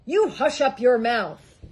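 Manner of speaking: loud angry howl; howled rebuke. A narrator's reading. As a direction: silence him with a roar. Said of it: "howled the mighty King Yertle".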